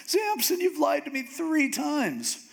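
very high, drawn-out wounded wail; mock upset. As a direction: overact the hurt, pitch way up. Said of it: "she's just so upset".

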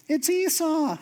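high, strained fake Esau voice; squeezing his voice up to pass as his brother; faked voice. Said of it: "He goes".

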